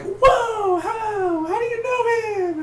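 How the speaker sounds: put-on very high, amazed kid voice; squeaky falsetto, mock astonishment; William. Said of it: "William would have been like".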